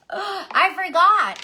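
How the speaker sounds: sharp gasp, then high, loud and slow "I forgot"; retelling a panicked gasp of remembering, stretched out; sudden realization. Retold or imitated. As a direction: gasp as if it just hit her. Suddenly remembering the child left behind.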